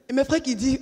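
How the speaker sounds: normal talking voice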